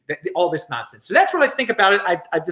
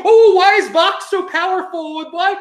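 high, loud mock whine of complaint; exaggerated wail of protest, mocking; mock complaining; 12 semitones higher, 5 dB louder than usual. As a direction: imitate a naive complainer, pitched way up. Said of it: "and then they'll complain".

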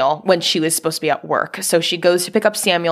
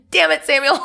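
mock exasperation; 9 semitones higher, faster than usual. Real, not acted. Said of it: "mock scolding the character".

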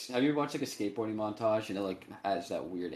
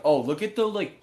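higher, louder mock-excited quote; mock gushing; 7 semitones higher, 8 dB louder than usual. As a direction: imitate an over-impressed film fan. Acting out gushing style fans.

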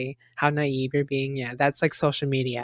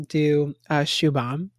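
usual speaking voice

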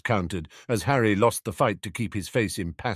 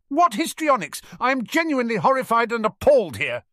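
loud, very high, rapid protest; indignant huff; 6 dB louder than usual. A narrator's reading. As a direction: huff with high-pitched outrage. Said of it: "Sirius huffed indignantly".

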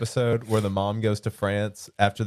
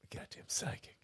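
whispered aside; 22 dB quieter than usual. Real, not acted. Muttered aside to himself.

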